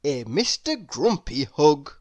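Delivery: plain reading voice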